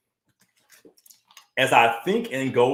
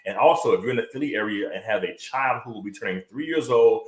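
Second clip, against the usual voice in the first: ordinary talk, nothing marked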